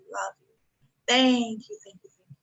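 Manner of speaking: ordinary talk, nothing marked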